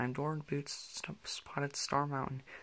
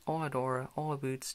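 ordinary delivery, nothing marked

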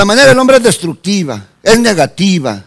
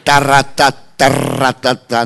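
rolled-R "ta-rra-ta" fanfare; mock fanfare; 4 semitones lower, slower than usual. Preacher announcing himself as founder's grandson, mock fanfare. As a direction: roll the R like a drumroll, drawn out.

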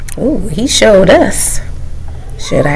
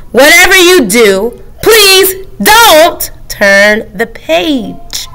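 very slow, drawn-out please; 6 semitones higher, much slower than usual. Acted. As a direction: stretch every word. Book pleads slowly.

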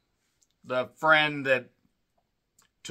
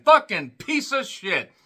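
disgusted curse; 5 semitones higher, 6 dB louder, slower than usual. Real, not acted. Condemning an abuser.

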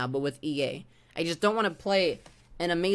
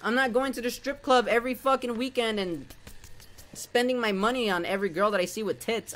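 usual speaking voice

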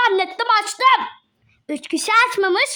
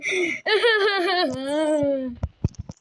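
laugh; 4 semitones lower than usual. Acted. Laughing while hiding under covers.

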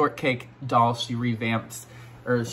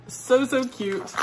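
high, slow coo; gushing; 11 semitones higher, slower than usual. Real, not acted. Vlogger cooing over kitten figures, high and slow.

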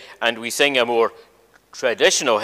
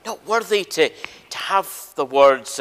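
put-on higher, pleading voice of a reluctant young prophet; young Jeremiah protesting; 8 semitones higher than usual. Preacher voicing the young prophet protesting to God, higher and pleading. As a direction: plead up high like a boy who feels too small for the job. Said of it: "and he says".

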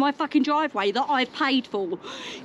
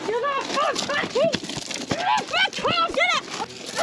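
high, drawn-out struggling shrieks; frantic fury; 10 semitones higher, slower than usual. Real, not acted. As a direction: protest mid-struggle, voice way up. Physical struggle over car.

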